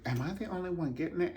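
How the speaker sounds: high, fast, wide-eyed wonder; amazed inner thought. Retold or imitated. Watching the dancers, wondering if only she gets it. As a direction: ask yourself in quick delighted disbelief. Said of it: "I was like".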